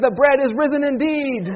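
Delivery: high, ringing formal reply; mock-grand Easter response; mock liturgical response. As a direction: answer like a congregation, hammed up. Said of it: "We don't talk like that".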